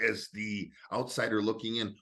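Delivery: normal talking voice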